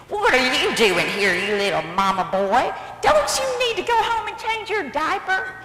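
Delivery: loud mocking taunt, swooping high; insulting giant. Giant saw David and began insults, loud jeering. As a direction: jeer at him, ending in high mockery. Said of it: "the insults began".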